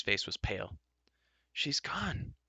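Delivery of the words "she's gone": high, softer gasp. A narrator's reading. Terran gasping in shock at the lost pig. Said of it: "he gasped".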